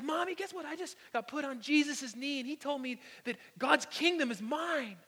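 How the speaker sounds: high-pitched, imitated child bursting with news; excited little kid. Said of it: "he's giggling and he's laughing".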